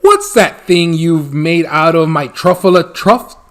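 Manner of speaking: loud outraged Lorax; angry shout. Acted. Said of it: "he shouted and puffed".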